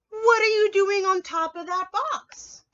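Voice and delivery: high, loud and slow call to the rabbit; loud storybook question. Acted. Reading question aloud.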